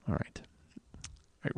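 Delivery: breathy whisper, barely voiced; whispered aside. Real, not acted. Host whispering to a guest who is leaving, almost no voice. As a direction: whisper it so the mic barely hears.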